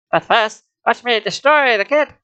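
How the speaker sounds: gloating cheap villain threat; discount Skeletor. A narrator's reading. The mutant threatens the kid, cheap Skeletor gloat. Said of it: "discount Skeletor voice".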